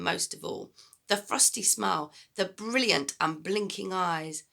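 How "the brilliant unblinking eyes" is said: ordinary delivery, nothing marked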